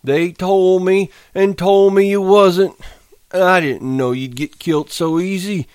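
a big simple man mourning his pup; Lenny, grieving. Acted. Lenny talks sadly to the dead pup.

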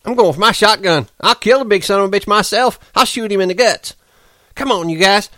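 high, fast, furious threats; Curly rallying the men, high, fast and furious; fury. A narrator's reading. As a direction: whip the men up into a lynching rage. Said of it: "worked himself into a fury".

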